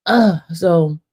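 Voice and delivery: ordinary talk, nothing marked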